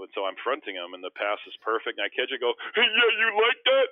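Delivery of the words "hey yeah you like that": much higher, loud cocky taunt; mock taunt. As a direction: crow at him like you've already scored. Taunting after catching pass.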